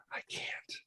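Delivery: scared breathless whisper; terrified little brother, whispered; frightened whisper. Retold or imitated. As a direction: barely breathe the words out. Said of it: "he looked like something was wrong".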